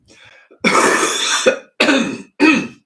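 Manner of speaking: heavy wet chest cough; chesty cough. Real, not acted. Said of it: "I've got a wicked chesty thing".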